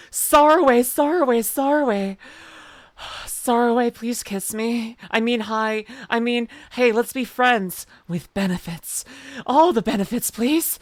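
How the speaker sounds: high, loud mock-lovesick calling; smitten fangirl pleading. Fan begging Sarway for affection, smitten pleading. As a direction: call out to your crush, high and gushing.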